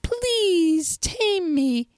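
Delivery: high, very slow pleading fox. A narrator's reading. Fox begs the little prince to tame him, slow and high. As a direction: draw out the plea to be tamed. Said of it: "he said".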